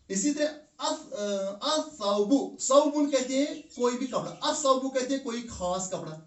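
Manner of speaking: ordinary talk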